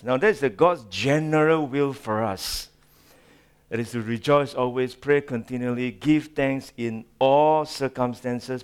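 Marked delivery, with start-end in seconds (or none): none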